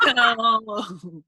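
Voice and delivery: words squeezed out mid-laugh; laughing while talking; laughing through it. Real, not acted. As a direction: still giggling as you restart. Still laughing while resuming.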